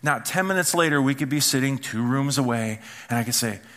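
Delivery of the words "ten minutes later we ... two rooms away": usual speaking voice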